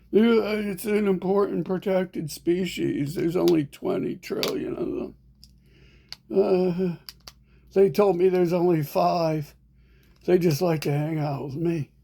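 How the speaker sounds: high, mocking voice of smug defenders; sarcastic mock quote. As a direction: sneer it as if parroting fools. Mocking imagined defenders of mosquitoes.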